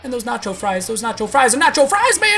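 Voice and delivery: builds from normal to a shrieking high chant; nacho fries frenzy. Real, not acted. Sleepy streamer craving nacho fries, shrieking chant.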